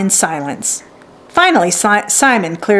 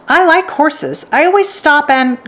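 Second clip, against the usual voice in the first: put-on a touch higher, brighter girl's voice; lightly voiced eager girl; young Hart, eager; 6 semitones higher than usual. A narrator's reading.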